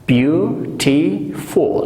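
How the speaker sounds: beautiful stretched out very slowly, beau-ti-ful in three beats; slow syllable demo. Shown as a demonstration. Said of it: "It has three syllables". («beautiful» خیلی آهسته و هجا به هجا)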